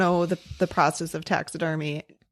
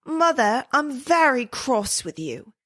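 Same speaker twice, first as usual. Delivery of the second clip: put-on high-pitched, prim British toddler; mock British accent, pitched up; strong British accent; 6 semitones higher than usual. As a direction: play a cross little Brit. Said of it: "in a strong British accent".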